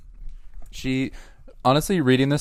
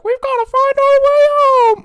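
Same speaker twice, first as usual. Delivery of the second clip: high, slow, sing-song cartoon animal voice; mock Disney animal; 8 dB louder, slower than usual. Mocking a Disney Channel movie, hammed-up cartoon animal voice. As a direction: ham up a cheesy cartoon pet.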